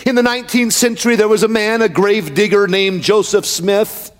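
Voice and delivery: emphatic pulpit delivery; full-force preaching. Real, not acted. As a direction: preach it steadily in measured, rhythmic phrases.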